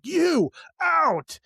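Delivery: high, imitated banishing command; mock angry order. Man pointing at speaker's wife, mock angry order to leave.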